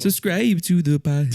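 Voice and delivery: high put-on rapper voice. Retrying the rapper sign-off for podcast listeners, high-pitched impression. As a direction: push it up high in an imitated voice. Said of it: "I was trying to do it".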